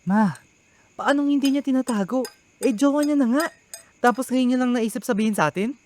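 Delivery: high, indignant protest; heated objection. Acted. Son objecting heatedly to his mother, indignant. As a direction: argue back at mother, worked up.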